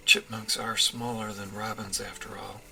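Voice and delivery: ordinary delivery, nothing marked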